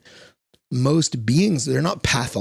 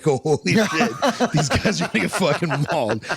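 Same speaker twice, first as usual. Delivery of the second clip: higher, laughing through the words in mock shock; laughing disbelief; 6 semitones higher than usual. Watching lions about to maul the men, laughing in disbelief. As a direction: giggle it out as if you can't believe what you're watching. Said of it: "you're like".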